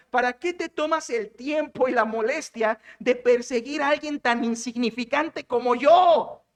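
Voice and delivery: slow, drawn-out oratorical question; preacher declaiming a humble appeal; impassioned rhetorical plea. Acted. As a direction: build to a stretched, emphatic final word. Acting David's plea to Saul.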